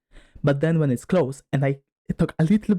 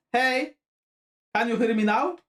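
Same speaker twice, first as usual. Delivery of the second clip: higher pitched, calling from across the room; far from the mic; 9 semitones higher than usual. Shown as a demonstration. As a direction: pitch it up as if shouting to someone far off. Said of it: "If I sit, like, so far away".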